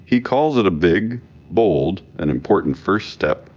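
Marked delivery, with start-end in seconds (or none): none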